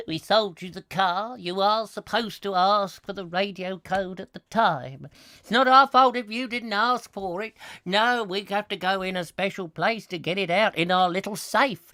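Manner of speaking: hammed-up garage employee; mock car dealer. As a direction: explain officiously in a comic character voice.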